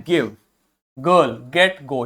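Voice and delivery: गिव, गर्ल, गेट, गो each with hard g; hard g sound. Shown as a demonstration. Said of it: "यानी ग sound देगा".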